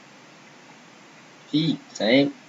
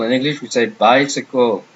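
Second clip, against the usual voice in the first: ordinary talk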